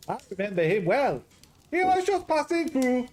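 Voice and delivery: high, loud hammed-up Greek-Scottish accent; a jolly Greek cook with a Scottish lilt, up high and loud; garbled Greek-Scottish accent. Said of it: "Garbled Greek Scottish".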